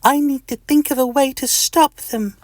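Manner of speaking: very high-pitched little creature voice; Mister Itch. Acted. Mister Itch plotting against the fingernails, high squeaky voice.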